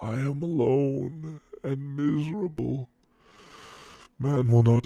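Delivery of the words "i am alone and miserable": soft, high-pitched plea of misery; lonely, wretched lament; forlorn lament. Acted. Creature laments its loneliness to its maker.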